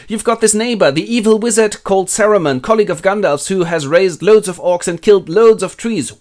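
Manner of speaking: high, loud, animated telling; eager tattling. Acted. Hobbit reports evil neighbor to Ent, high animated voice. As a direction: stir him up with the news.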